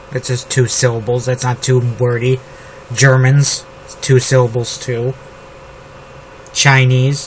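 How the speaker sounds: usual speaking voice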